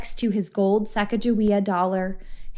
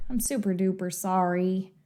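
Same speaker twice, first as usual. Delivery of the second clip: soft, low apology; hushed, lowered remorse; apologetic; 4 semitones lower, 6 dB quieter than usual. A narrator's reading. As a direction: say sorry gently. Judy apologises to grieving brother.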